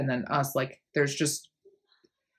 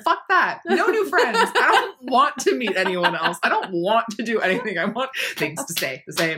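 high, loud mock tantrum; defiant inner voice; 5 semitones higher, 5 dB louder than usual. Part of her refusing change, defiant inner voice. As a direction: protest it high and loud, half laughing.

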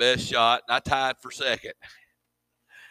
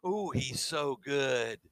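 mock admiration; 7 dB quieter, slower than usual. Pastor imitating the crowd praising a preacher, gushing mockery. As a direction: mimic the swooning crowd. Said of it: "everybody said".

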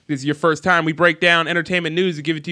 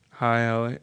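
small, low, soft mumble; hushed, low and crushed; broken, imitated; 6 semitones lower, 7 dB quieter than usual. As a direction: imitate a starstruck fan barely getting words out. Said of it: "he was like, like broken".

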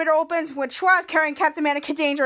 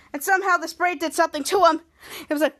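ordinary delivery, nothing marked

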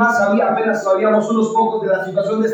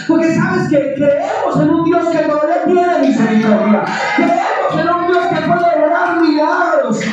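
fervent preaching; 5 semitones higher than usual. Real, not acted. Pastor rousing the congregation, fervent high preaching. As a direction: lift the church up, preach it out.

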